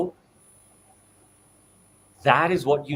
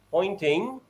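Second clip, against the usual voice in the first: ordinary delivery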